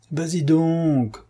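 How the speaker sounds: high, slow impatient command; impatient order. A narrator's reading. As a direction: urge him on with slow impatience. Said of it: "ordonna Don Luis impatienté". (ordre impatient, aigu et lent)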